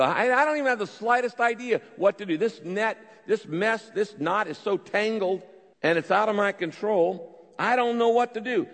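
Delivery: frantic exasperated prayer; exasperated outburst. Retold or imitated. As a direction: throw up your hands, can't untangle it. Voicing a frantic person at a loss.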